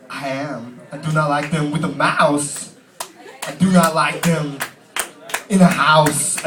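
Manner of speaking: slow, halting Walken read with odd pauses; Christopher Walken. Pretend. Comic reads Green Eggs and Ham to crowd as Walken, slow. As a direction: read it like Walken, every phrase broken apart. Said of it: "I read it like him".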